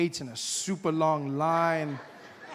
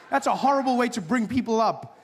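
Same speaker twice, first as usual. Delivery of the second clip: usual speaking voice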